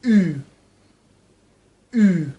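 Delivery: "او" held long with pursed lips, between ee and oo; drawn-out rounded vowel. Shown as a demonstration. Teacher models French U for learners, "او" long and lip-rounded. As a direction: stretch "او" through tightly rounded lips. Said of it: "به صورت کشیده با قنچه کردن لب".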